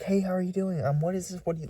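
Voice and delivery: high, eager chatter; imitating the woman's eager, high chatter; excited fast talk. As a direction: gush at him too brightly. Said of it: "she's talking fast, talking, like, excitedly".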